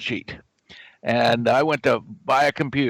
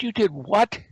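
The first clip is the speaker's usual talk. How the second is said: incredulous; 6 semitones higher than usual. Retold or imitated. Retold disbelief at memorized algorithms.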